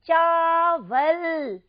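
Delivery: चावल very slow and loud, long आ in चा; syllable by syllable. Shown as a demonstration. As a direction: stretch चावल out for pupils to repeat. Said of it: "मेरे साथ साथ बोलेंगे आप".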